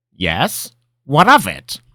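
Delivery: put-on high, loud, testy old man; old wizard, testy. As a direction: bristle like a cranky wizard. Linram challenges apprentice.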